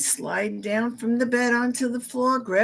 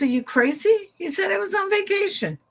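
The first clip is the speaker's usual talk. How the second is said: higher, puzzled indignant father; incredulous father; 11 semitones higher than usual. Retold or imitated. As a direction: act baffled by the silly question. Father surprised at question.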